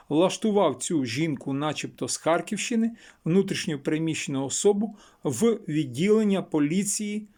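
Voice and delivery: ordinary delivery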